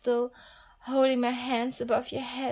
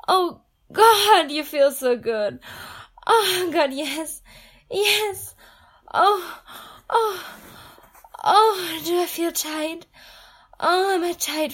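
loud, high climax moans; loud high pleasure cries; 3 semitones higher, 6 dB louder than usual. Acted. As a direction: let the moans break out loud.

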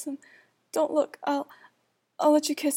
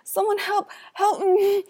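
high sobbing cries for help; crying; 7 semitones higher than usual. Acted. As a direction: sob and wail, voice pitched high. Assaulted princess crying for help.

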